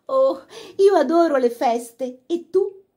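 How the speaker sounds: slow, loud, high, breathless. A narrator's reading. Laura hugging her brother, breathless and excited. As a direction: gush out of breath with joy. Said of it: "disse ansimante".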